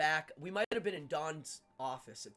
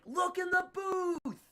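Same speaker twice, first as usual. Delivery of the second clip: high, loud, drawn-out call; slow mock holler, pitched way up; imitated yelling; 13 semitones higher, 5 dB louder, slower than usual. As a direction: stretch it out like yelling over a band. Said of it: "was yelling at me".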